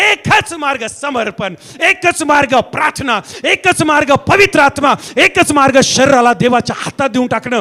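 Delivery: loud, driving sermon, line after punched line; full-force preaching. Real, not acted. (जोरदार, आवेशपूर्ण प्रवचन)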